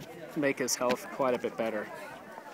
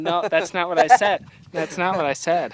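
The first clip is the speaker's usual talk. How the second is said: loud mock protest, pitched up; Ben; 5 semitones higher, 12 dB louder than usual. Imagining a friend arguing with the video, loud mock indignation. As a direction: imitate an indignant friend objecting. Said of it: "Ben's gonna be watching this going".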